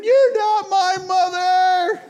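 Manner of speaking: put-on high, slow, loud child's scream. Toddler screams at mom leaving the store, high loud wail. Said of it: "J M screaming".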